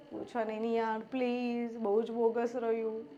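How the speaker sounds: very quiet, low and deflated; dejected student. Acted. Student dodging questions about a bad exam, dejected. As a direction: sound crushed, barely wanting to talk.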